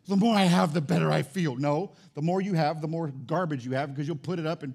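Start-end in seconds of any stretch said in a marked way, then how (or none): 0.1-1.5 greedy voice. Retold or imitated. Voicing a greedy person.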